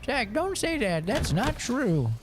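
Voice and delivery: high shocked scolding voice; mimicking scolding mom. Mom scolding son for rude remark, high and shocked. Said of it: "She was like".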